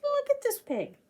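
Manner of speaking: ordinary talk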